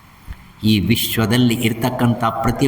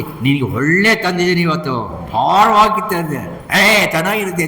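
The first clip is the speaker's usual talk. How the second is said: acted enthusiasm; 6 semitones higher than usual. Acted exchange in retold tale.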